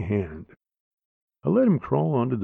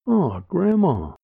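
put-on child voice; 7 semitones higher, 3 dB louder, slower than usual. Child whines to grandma.

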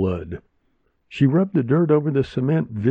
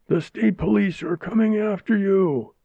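high screamed threat; screaming; 10 semitones higher than usual. A narrator's reading. Angry woman screams a threat at the door, high. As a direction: shriek the threat on. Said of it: "the woman was screaming".

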